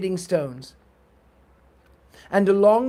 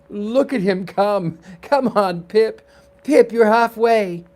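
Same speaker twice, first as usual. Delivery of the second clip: urgent, louder rallying shouts; cheering on a runner; 4 semitones higher, 6 dB louder than usual. Acted. Boys cheer Pip through the race, excited. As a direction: build to a high, loud cheer.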